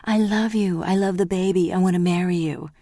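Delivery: a man mimicking what he is expected to say; mock lover. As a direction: sneer at the imagined confession. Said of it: "make me go rushing to her and say".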